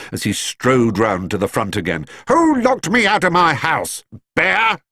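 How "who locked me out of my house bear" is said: high, indignant barked demand; angry bark. A narrator's reading. Mister Curry hunting for whoever locked him out, barking. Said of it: "he barked".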